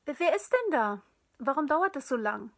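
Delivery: laut und höher gerufen; aus dem Nebenzimmer rufend. A narrator's reading. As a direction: ruf es aus dem anderen Raum herüber. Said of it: "tönte es nun aus dem Wohnzimmer". (lauter Ruf aus dem Wohnzimmer)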